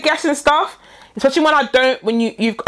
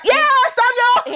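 high, drawn-out mock cheer; over-excited confirmation; 10 semitones higher, much slower than usual. Answering his typed question by voice note, over-excited. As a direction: stretch it out, bursting with fake joy. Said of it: "the most excited voice note".

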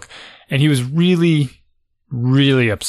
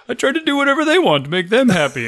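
imitating his son; 9 semitones higher than usual. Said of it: "He's like".

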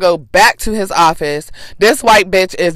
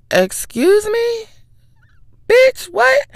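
shrill, much higher incredulous "excuse me"; outraged disbelief; much slower than usual. Retold or imitated. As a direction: squeal it out like you can't believe your ears. Outraged reaction to racist remark.

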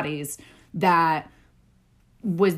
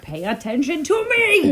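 playful demand; 8 semitones higher, 4 dB louder than usual. Pretend.

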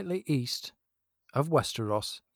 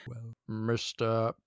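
grind it out like a braking steam train; steam-engine slow impression; 8 dB louder, much slower than usual. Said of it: "like a steam engine coming to a halt".

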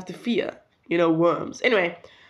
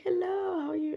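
higher, softer friendly greeting; lightly voiced jolly Santa; 11 semitones higher, 7 dB quieter than usual.

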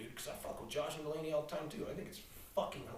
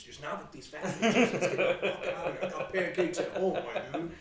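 mock exasperated refusal; 3 semitones higher, 9 dB louder than usual. Retold refusal to get pancakes.

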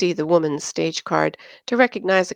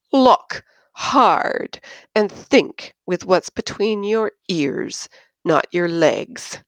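scornful order; slower than usual. Acted. Drunk Yin scolds Christine over the graph, slow scorn. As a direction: hammer each word at her with contempt.

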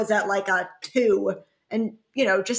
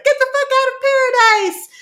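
mock dismissal; 4 dB louder, much faster than usual. Jasenia voiced throwing him out.

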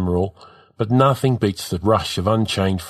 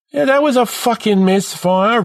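high-pitched, dejected complaint; despondent; 13 semitones higher, 4 dB louder than usual. A narrator's reading. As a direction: complain like a kid who knows he's done for. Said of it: "Rizza says, despondent".

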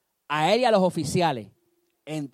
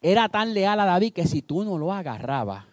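ordinary talk, nothing marked

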